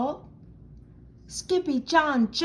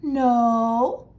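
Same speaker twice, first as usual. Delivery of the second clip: firm mother's no; 5 dB louder than usual. A narrator's reading. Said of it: "answered Mama Junebug Jones".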